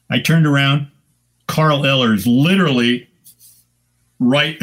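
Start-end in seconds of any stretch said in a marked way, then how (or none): none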